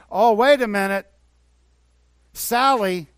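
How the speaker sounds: a loud, gloating Satan spotting a new target; Satan scheming. Retold or imitated. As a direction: ham up the devil plotting against her. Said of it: "Satan will turn up the heat".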